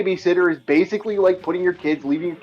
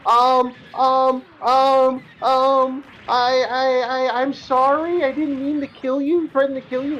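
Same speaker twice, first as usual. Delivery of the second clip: put-on pleading kid; 6 semitones higher than usual. Kid pleading after being grounded.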